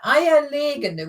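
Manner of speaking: Eierlegende drawn out with a long LE; stress on LE. Shown as a demonstration. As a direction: model Eierlegende slowly, long first vowel in legende. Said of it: "which is an egg laying".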